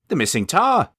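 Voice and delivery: suddenly spots the lost tar; surprised exclamation. Acted. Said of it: "he exclaimed".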